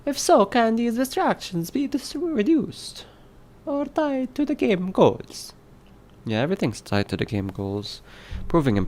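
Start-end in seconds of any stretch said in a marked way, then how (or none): 0.1-5.5 high comic Italian reading voice; Italian accent. Acted. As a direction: keep the hammed-up Italian accent going. Italian voice for lens question.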